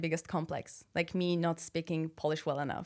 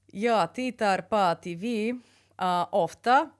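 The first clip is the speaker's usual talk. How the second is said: put-on Russian accent; 4 dB louder, much slower than usual. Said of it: "speak Swedish with Russian accent".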